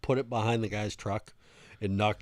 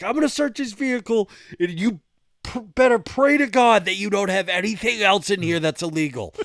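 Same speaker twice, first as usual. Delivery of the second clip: high, loud imitated threat; trooper losing it; 13 semitones higher, 12 dB louder than usual. Trooper about to search the driver's vehicle, threatening yell. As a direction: shout the warning as an enraged trooper. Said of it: "Fucking loses it".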